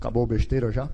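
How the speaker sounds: low, scoffing husband brushing it off; dismissive husband. Acted. Husband scoffing at his crying wife, low and dismissive. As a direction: play the tough husband who won't cry, deep and gruff.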